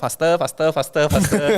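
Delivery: put-on Thai accent. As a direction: push him on in a Thai accent. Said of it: "in the Thai accent".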